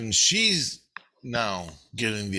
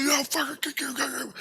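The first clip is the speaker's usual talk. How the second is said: high, squeezed tirade without real volume; mock yelling. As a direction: imitate someone yelling in your head. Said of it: "my subconscious is yelling at me".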